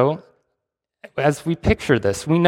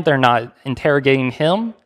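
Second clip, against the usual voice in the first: usual speaking voice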